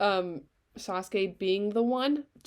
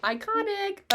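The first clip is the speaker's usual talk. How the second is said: gushing squeal; 12 semitones higher, slower than usual. Real, not acted. Gushing about Minato.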